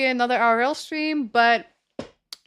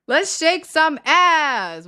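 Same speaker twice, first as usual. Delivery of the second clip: drawn-out hype; 4 semitones higher, 5 dB louder, much slower than usual. Real, not acted. Hyping chat before song.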